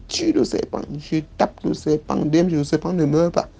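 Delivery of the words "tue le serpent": sharp high order; nanny's command. Retold or imitated. The nanny ordering the child to kill the snake. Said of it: "Elle me dit".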